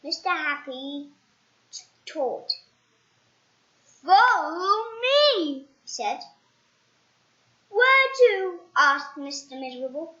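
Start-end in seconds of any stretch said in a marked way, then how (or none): 3.8-5.4 slow, higher, louder invitation; slow cheerful command. Acted. Mister Happy leads the way. 7.7-8.6 high, louder squeaky voice; squeaky question. Acted. Mister Miserable asks.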